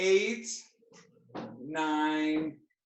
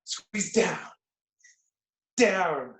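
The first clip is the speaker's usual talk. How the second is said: ordinary talk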